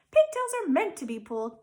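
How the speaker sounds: high, loud tempting little voice; squeaky temptress pigtail. A narrator's reading. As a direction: coax him like a tiny imp.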